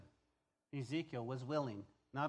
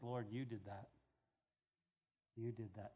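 humble hush; 7 semitones lower, 9 dB quieter than usual. Acted. Humble prayer giving God credit.

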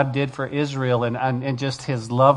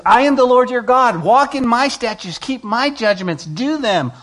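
high, loud declaration of God; fiery pulpit proclamation; proclaimed command; 9 semitones higher, 5 dB louder than usual. Acted. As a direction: boom out the Lord's decree. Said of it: "He says".

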